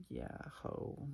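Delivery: very soft, whispery aside; hushed acted reply; whispered reply. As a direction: whisper it as a private reaction. Acted muttered reply to him.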